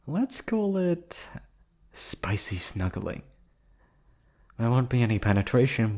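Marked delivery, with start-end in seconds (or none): 0.1-3.2 high and slow, playfully suggestive; coy tease. Acted. Captor coyly describing future intimacy, high slow tease. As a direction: stretch it out with a coy lilt.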